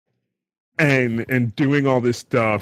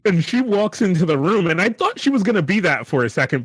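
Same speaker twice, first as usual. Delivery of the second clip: ordinary talk, nothing marked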